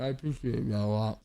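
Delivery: talking with a mouth full of food. Real, not acted. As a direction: mouth full, chewing while speaking. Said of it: "I got my mouth full right now".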